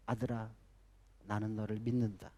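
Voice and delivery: soft, low fatherly words; tender reassurance. Retold or imitated. Bill Gates's father telling his son he believes in him, tender and soft. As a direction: say it softly, like a trusting father.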